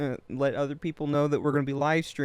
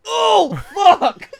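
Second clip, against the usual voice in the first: high, loud shocked yelp; shocked shout; 8 dB louder, much slower than usual. Real, not acted. Shocked by the smelling salts.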